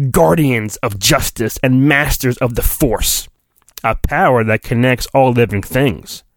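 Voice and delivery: normal talking voice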